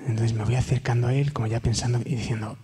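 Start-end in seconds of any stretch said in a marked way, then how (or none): none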